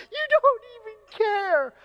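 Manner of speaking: very high, drawn-out mock wail; wailing child. Heartbroken daughter accusing her dad, wailing. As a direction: imitate an upset little girl's shriek.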